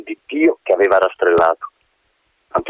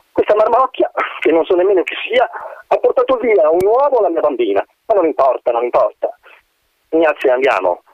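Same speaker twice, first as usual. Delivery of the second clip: haughty mother; 7 semitones higher than usual. A narrator's reading. Mother of Ignazia speaks scornfully.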